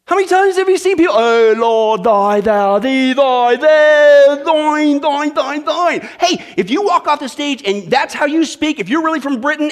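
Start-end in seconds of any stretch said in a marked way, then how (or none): 1.2-6.0 loud, higher hammed-up thee-and-thou praying; mock pious prayer. Pastor mocking people who pray in thee and thou. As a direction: lampoon the flowery prayer, getting louder. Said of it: "How goofy is it then that we do that with God".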